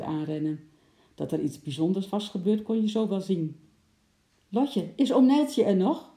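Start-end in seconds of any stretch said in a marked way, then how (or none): 4.5-6.1 high, loud calling voice; urgent call. A narrator's reading. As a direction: call out anxiously, pitched up and louder. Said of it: "riep hij buiten adem".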